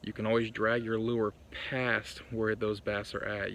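usual speaking voice

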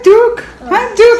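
high sing-song pet voice. Real, not acted. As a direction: coo at the dog. Greeting the dog.

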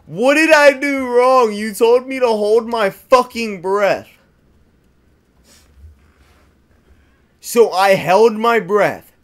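exasperated rant. Real, not acted. Ranting after a character died.

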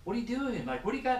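high, puzzled voice of other anglers; mock baffled fisherman. Anglers asking what bait he uses, high and puzzled. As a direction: squeak it like an amazed stranger.

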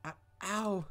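lightly voiced girl yelping in pain; a hint of a girl's ow; mock female ow. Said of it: "I'll go with female voice".

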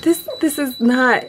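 ordinary talk, nothing marked

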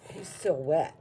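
ordinary talk, nothing marked

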